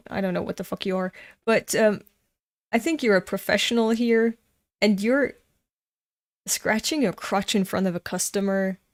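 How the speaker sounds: ordinary talk, nothing marked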